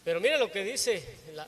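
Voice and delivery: normal talking voice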